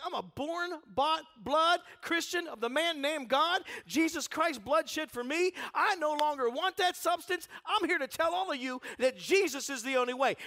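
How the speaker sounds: high, loud testimony proclaimed; fiery preaching. Acted. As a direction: shout it out like a revival preacher. Preaching the declaration at full force.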